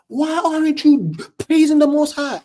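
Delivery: high, mock-screamed rebuke; screaming. Preacher acting out the rebuke he wishes he heard, screaming. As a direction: call it out high like an indignant zealot.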